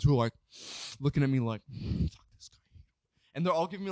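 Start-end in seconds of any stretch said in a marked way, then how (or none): 1.7-2.8 slow, softer mock whisper; hostile stage whisper. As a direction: whisper behind someone's back, imitating them. Mimics hostile friends whispering at him.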